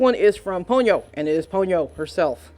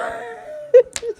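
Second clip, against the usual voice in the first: laugh-cry. Real, not acted. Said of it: "I cried a little inside".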